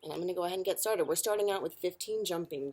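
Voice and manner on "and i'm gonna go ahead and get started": normal talking voice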